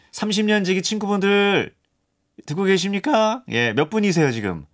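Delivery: high-pitched, rousing call to the listeners; 청취자를 부르기. Real, not acted. DJ calls out to listeners. (높은 톤으로 힘차게 부르기)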